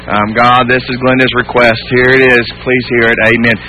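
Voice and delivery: low, toneless recital of a request; droning, without feeling; mechanical rote prayer. Acted. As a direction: read it off like an item on a list. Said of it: "he's not just taking it back to God and saying".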